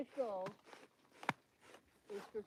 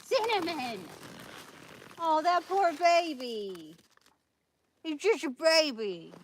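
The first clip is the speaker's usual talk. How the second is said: loud, high dramatic outcry; mock scolding; 6 semitones higher, 14 dB louder, slower than usual. Owner scolding one pet over the poor baby, loud mock dismay. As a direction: exclaim it with drawn-out, hammed-up pity.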